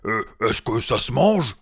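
hesitant, puzzled troll question; dim-witted troll. Acted. Tom wondering if the hobbit is food, loud puzzlement.

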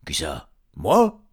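indignant surprise. Acted. Léon wrongly blamed by Hubert, indignant surprise. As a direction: leap up in protest on "Moi". Wrongly accused troll protests.

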